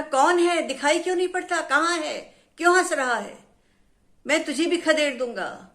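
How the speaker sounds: angry shout. Acted. Angry tree shouting at an unseen voice, furious shout. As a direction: bellow at the hidden laugher. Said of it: "पेड़ को बहुत गुस्सा आया".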